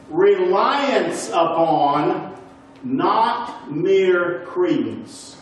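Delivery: "reliance upon, not mere credence" read very slowly, each phrase set apart by pauses; slow, weighted quote. Acted. Teacher reading a Greek definition to the class, slow and weighted.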